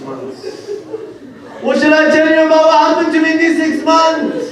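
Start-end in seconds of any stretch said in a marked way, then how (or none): 1.6-4.4 high, loud, lamenting chant; wailing recitation. Shown as a demonstration. Preacher repeating Zainab's words as a loud wail. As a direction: cry the same line out in grief. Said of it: "repeated phrase".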